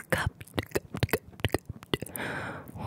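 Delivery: crisp repeated ASMR mouth triggers; whispered trigger word. Shown as a demonstration. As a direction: tap out each cup as a trigger. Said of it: "ear to ear whisper ramble".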